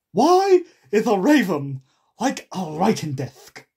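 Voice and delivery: put-on madcap voice; Hatter. A narrator's reading. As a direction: pose a riddle, booming and drawn out. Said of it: "The Hatter opened his eyes very wide".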